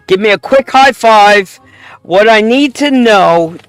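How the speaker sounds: ordinary talk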